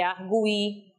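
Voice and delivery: "arguí" slowly, with a strong separate U, ar-GU-i; stressed U in arguí. Shown as a demonstration. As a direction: lean on the GU in "arguí". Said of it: "tem que ser emitido como forma tônica". ("arguí" com o U forte e tônico)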